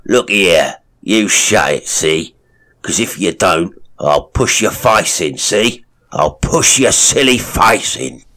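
loud cockney-ish menace; threatening thug. A narrator's reading. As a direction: lean in and threaten him. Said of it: "distorted with passion".

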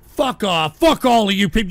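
usual speaking voice

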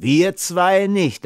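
slow, high, gloating reply; amused jeer. A narrator's reading. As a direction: draw it out as a taunt. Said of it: "lachten die beiden Bärtigen".

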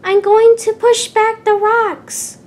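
Percy calling out as he pushes the rocks, determined; determined shout. A narrator's reading. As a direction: shout it as he charges.

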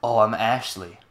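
normal talking voice

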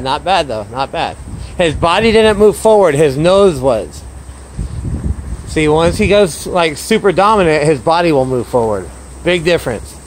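ordinary talk

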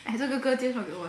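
ordinary delivery

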